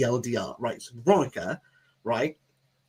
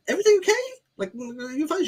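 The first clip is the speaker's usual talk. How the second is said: high-pitched, tentative question; acted wary check. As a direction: check on your date in a high voice. Retold wary check on his date.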